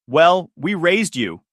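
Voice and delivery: sharp angry snap; snapping. A narrator's reading. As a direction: snap it back at him. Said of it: "she snapped".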